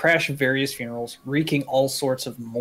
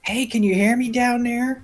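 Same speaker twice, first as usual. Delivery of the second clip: calling down a hallway; 10 semitones higher than usual. Acted. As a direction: call down as if far below. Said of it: "is she talking to a can".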